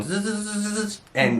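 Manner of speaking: rapid stuttering buzz; imitated buzzing. Wife imitates her husband's mind buzzing, rapid zzz.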